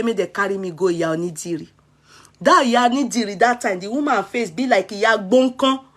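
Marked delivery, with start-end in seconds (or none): 0.0-1.7 mother's order. Retold or imitated. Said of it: "My mama go say".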